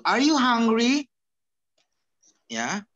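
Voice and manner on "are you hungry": "Are you hungry" high, loud and drawn out upward; slow rising lift on "hungry"; question intonation. Shown as a demonstration. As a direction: demonstrate the question, sweeping "hungry" up. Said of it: "Intonasinya begitu".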